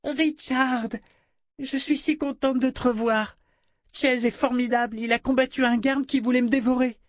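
put-on high, eager little girl's voice; a delighted small child gushing; child voice full of admiration; little girl Rachel.

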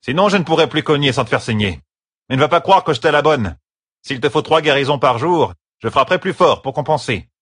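high, threatening disciplinarian; strict matron laying down the law; stern mistress of novices. Acted.

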